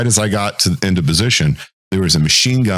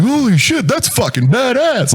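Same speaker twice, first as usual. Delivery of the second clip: high, buzzing with excitement; thrilled exclamation. Retold or imitated. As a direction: blurt it out like a rush of adrenaline. First time under fire.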